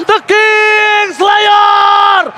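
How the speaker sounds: fighting game announcer. Real, not acted. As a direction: roar out the wipe out. Caster calls the wipe out.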